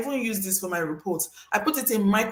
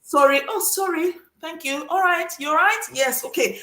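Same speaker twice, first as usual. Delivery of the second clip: high, sing-song polite apologies; light, high-pitched exchange of courtesies; overly courteous; 7 semitones higher than usual. Acted. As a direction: play polite strangers bumping into each other. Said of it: "It's polite society. Everyone just courteous".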